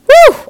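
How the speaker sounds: a high, loud whoop of joy; excited whoop. Real, not acted. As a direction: let out a burst of excitement. Excited by the scene.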